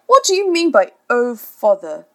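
loud indignant demand. A narrator's reading. Father rounding on Wendy, loud defensive demand. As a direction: bristle at the accusation. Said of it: "Mister Darling demanded".

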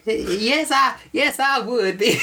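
shrieky high witch, breaking into laughter; high laughing witch shout. Acted. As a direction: squeal it as a hammed-up witch, cracking up. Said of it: "Trying to do a different voice".

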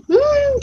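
high, drawn-out "mmm"; feigned puzzled hum. Pretend. Coach pretends not to know the fear, high feigned hum. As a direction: hum high as if you have no idea.

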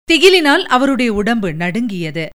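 plain reading voice

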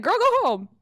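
high, loud sassy coworker; mock coworker shooing her home, high and loud; sassy girl voice. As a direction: throw it out like a sassy bestie. Imagined coworkers telling her to leave.

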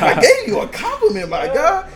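loud high laughing protest. Retold or imitated. Protesting to a friend, loud, high and laughing. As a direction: squeal it out while laughing. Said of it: "I was like".

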